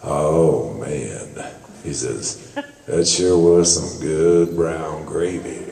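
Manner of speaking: very low, slow, deep drawl; deep Texan drawl. Retold or imitated. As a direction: drop the voice way down like a big Texan. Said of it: "a big old tall Texan with a real deep voice".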